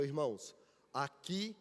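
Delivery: low, restrained, serious quote. Retold or imitated. Church elder answering the visiting brothers, in a serious voice. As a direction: imitate an elder gravely addressing visitors. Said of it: "com uma voz séria".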